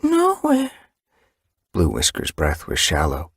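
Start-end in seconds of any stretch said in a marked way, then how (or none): 0.0-0.7 small kit voice. Acted. Hurt kit answers.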